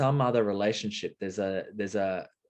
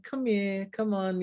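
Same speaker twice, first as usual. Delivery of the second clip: high, sweet call to a cat; coaxing a kitty; 10 semitones higher, slower than usual. Retold or imitated. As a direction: coax the cat over with a high voice. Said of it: "I'm like".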